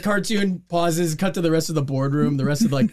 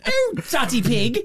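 higher, slow hammed-up moan; mock swooning pig; 7 semitones higher than usual. Cohost joining the Peppa Pig bit, mock moan.

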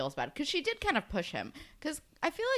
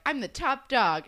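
mock-triumphant boast, higher and louder; celebrating; 7 semitones higher, 6 dB louder than usual. Eli boasts to Claire after his win, celebrating. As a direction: crow it like a winner. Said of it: "celebrating 'cause he's like".

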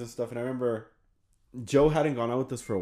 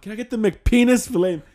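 prank order; 9 semitones higher, 5 dB louder than usual. Retold or imitated. Prank drive-thru order.

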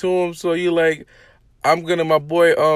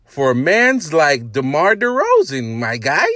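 put-on Toronto accent; 4 dB louder than usual. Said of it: "That's my best Toronto accent".

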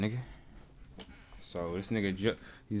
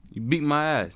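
loud, raised "you beat my ass"; emphatic complaint; 6 semitones higher, 7 dB louder than usual. Real, not acted. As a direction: blurt it out loud and high in one quick go. First take of a punchline.

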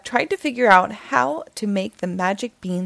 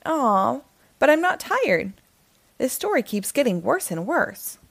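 put-on higher, pleading boy's voice; Jack's protest; 4 semitones higher than usual. Jack pleading with the narrator, higher boy voice.

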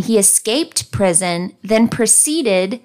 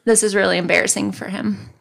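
ordinary delivery

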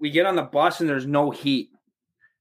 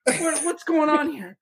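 much higher, outraged yelling; pissed-off coach; 11 semitones higher than usual. Retold or imitated. Coach furious at the drunk bus driver, mock yell. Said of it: "a coach was so pissed at the bus".

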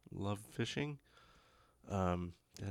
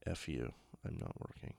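sulky phone voice; 7 semitones lower, 7 dB quieter than usual. Retold or imitated. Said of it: "so everything is like".

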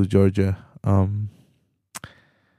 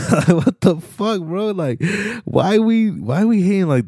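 laughing disbelief; 9 semitones higher than usual. Real, not acted. Venting to viewers about Latinos mugging each other, laughing disbelief.

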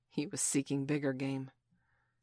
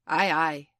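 Hook's voice; 8 dB louder than usual. A narrator's reading. Peter answering in Hook's voice.

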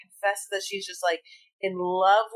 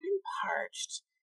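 raspy parched whisper; 3 semitones higher, 10 dB quieter than usual. Retold or imitated. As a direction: hiss it like dying of thirst. Acting sultry bar order.

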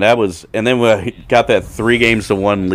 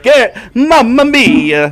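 slow, much higher hammed-up Italian accent; mock Italian exclamation; 7 dB louder, much slower than usual. Dad mocking Gaetti in hammed-up Italian accent. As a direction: drag out a loud cartoon Italian Mamma Mia. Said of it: "That's what he, he would say every time".

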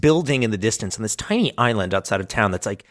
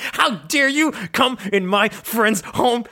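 mock battle cry; 10 semitones higher than usual. Voicing Banana Man fighting monster.

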